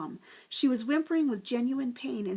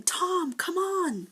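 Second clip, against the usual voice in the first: yelling; 9 semitones higher, much slower than usual. A narrator's reading. Ben yells to Tom to hurry away, a high urgent call. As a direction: call him urgently across the bridge. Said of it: "Ben was yelling".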